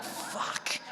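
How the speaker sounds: breathy defeated whisper; whispered curse. Acted. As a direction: mutter it under breath, beaten.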